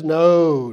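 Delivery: a firm, loud scriptural no; emphatic no. Shown as a demonstration. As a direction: plant the No like a verdict. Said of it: "read the text with the emphasis".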